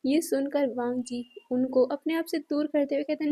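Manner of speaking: usual speaking voice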